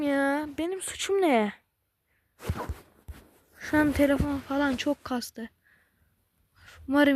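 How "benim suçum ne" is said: normal talking voice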